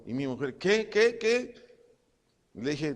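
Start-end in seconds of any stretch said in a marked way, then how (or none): 0.6-1.5 high, alarmed repeated question; startled wife. Retold or imitated. Husband imitating his wife hearing he is dying, startled. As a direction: imitate a wife jolted awake, pitched up.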